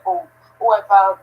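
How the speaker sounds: usual speaking voice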